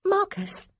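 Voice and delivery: put-on high, childlike call of his name; a small girl calling Marcus; little girl voice. A narrator's reading. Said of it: "she said".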